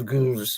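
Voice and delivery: "Guilloche" said the Google way, as "goosh"; Google's pronunciation again. Retold or imitated. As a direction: repeat the Google voice saying "Guilloche". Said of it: "It goes".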